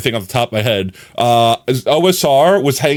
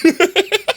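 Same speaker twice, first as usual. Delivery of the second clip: high squeaky giggle; squeaky laugh at the fooled friend. Real, not acted. Laughing at prank.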